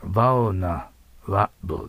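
slow バウナラブル opening on an English v; lip-bitten V. Shown as a demonstration. Teacher modelling for students, slow lip-bitten V. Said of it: "まず下唇を噛んでください".

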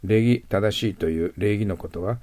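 usual speaking voice

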